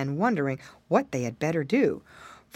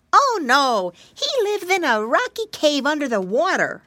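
high, loud piglet; little pig voice; 9 semitones higher, 4 dB louder than usual. Acted. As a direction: correct his brother like a piglet. Pig character in story.

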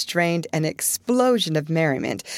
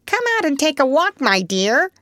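high, fast parrot squawk; funny parrot voice; 9 semitones higher than usual. A narrator's reading. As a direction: chirp it out like a parrot. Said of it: "saying in his funny way".

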